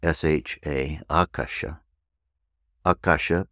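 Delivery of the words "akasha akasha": Akasha with the first syllable stressed; Sanskrit pronunciation. Shown as a demonstration. Teacher shows the proper pronunciation of Akasha. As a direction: say Akasha as AH-kah-sha. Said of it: "proper pronunciation".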